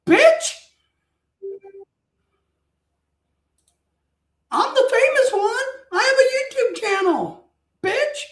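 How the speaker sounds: very high, mock-outraged squawk; indignant. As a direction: protest like a slighted star. Acted indignant thought at being overlooked.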